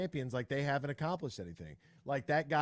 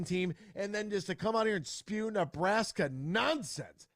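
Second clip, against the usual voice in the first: heated rant; 7 semitones higher than usual. Real, not acted. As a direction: go off in outrage. Host rants against Nebraska guest.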